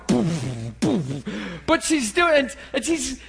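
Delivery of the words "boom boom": low, puffed-out "boom"; imitated head throbbing. Preacher imitating a woman with a splitting headache, throbbing booms. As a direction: let each boom burst and trail off. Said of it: "she's sitting there going".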